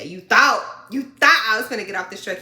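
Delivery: loud, fast defiant jab. Real, not acted. Sassing the trainer who wanted her off, loud and quick.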